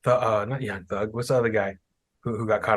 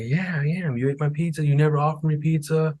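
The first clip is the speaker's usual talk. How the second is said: higher-pitched, indignant comeback; mock aggrieved kid; 6 semitones higher than usual. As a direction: imitate a kid defending a grudge. Acting the aggrieved younger kid.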